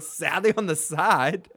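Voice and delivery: slow questioning repeat with rising end; retold incredulous echo. Jon Snow repeats Sam's phrase, retold incredulity. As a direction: echo it in mock disbelief.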